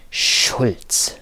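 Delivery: agitated, rasping stage whisper; hoarse whisper. A narrator's reading. Boss leaning close over the desk to employee, hoarse whisper. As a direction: hiss the surname, hoarse with agitation. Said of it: "flüsterte er heiser vor Erregung".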